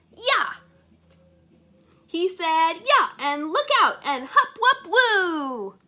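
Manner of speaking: high, loud, gleeful yells; hyped-up ninja hollering; excited ninja yells. Acted. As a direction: whoop through the kicks. Hero practicing ninja moves.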